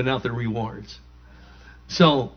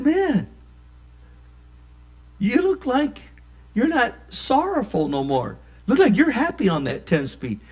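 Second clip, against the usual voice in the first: much higher teasing voice; teasing passerby; 9 semitones higher than usual. Retold or imitated. Passerby on the corner teasing him in a much higher voice. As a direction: tease him about the bike.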